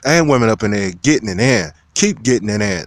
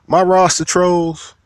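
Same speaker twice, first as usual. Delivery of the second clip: drawn-out call-out; 8 semitones higher, slower than usual. Real, not acted. Calling out to his trolls.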